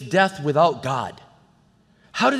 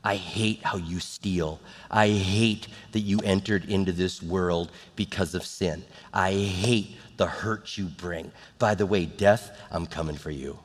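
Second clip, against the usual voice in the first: quiet anger; 9 semitones lower, 7 dB quieter than usual. Retold or imitated. Jesus confronting death at Lazarus's grave, quietly angry. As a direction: address the enemy softly, dragging out the hate. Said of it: "he's angry with it".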